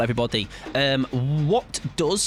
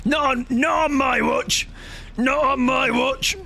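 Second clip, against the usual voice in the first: heroic vow; 10 semitones higher than usual. Pretend. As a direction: ham up a heroic rescue vow. Streamer hams up heroic vow.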